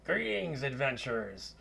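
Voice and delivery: put-on high opening, Scottish-accented greeting. Wizard greets adventurers at his door, Scottish accent. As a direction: hail them like a Scottish wizard. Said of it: "a Scottish accent".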